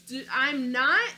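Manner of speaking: ordinary talk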